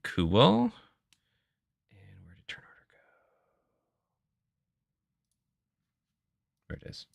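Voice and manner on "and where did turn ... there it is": whispered aside to himself; whispering. Real, not acted. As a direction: mutter it under your breath. Muttering to himself while searching.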